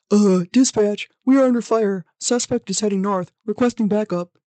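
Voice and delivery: tense cop on the radio; police radio. Pretend. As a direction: call it in over a police radio. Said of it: "the dispatch officer trying to radio this in".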